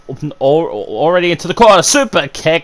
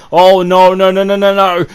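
anxious no no no; 4 semitones higher, 3 dB louder than usual. Real, not acted. Commentator dreading a spot.

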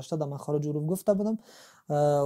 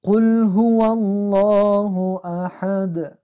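slow chanted قل هو الله احد with a full, heavy الله; slow recitation; 4 semitones higher, 8 dB louder, much slower than usual. Shown as a demonstration. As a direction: recite loudly and drawn out. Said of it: "حرفا به رنگ سبز آمده".

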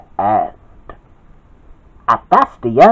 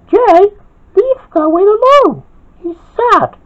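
very high-pitched character voice; high, insistent telling-off; protective scolding. A narrator's reading. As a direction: stick up for a friend, high and urgent. Samira scolds Jay for yelling.

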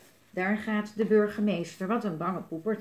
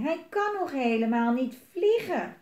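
put-on raised-pitch childlike plea; calling out; 5 semitones higher, 4 dB louder than usual. A narrator's reading. Twins explain the dragon cannot fly, calling out. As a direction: plead as indignant children. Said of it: "riep de tweeling".

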